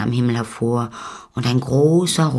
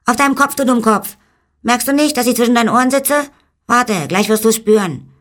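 very high, loud, threatening tiny-man voice; exasperated little man; 12 semitones higher, 6 dB louder, faster than usual. Acted. Little man threatens the donkey he sits on, high and exasperated. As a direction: rail at him as a furious little sprite.

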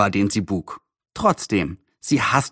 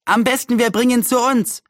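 put-on high-pitched boy calling; voiced neighbour boy, pitched well up; 10 semitones higher than usual. A narrator's reading. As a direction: call out your suggestion eagerly. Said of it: "rief einer der Nachbarjungen".

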